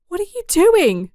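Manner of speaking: very high, loud cry; horrified shout. Acted. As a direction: scream in shock. Uni screams at her father.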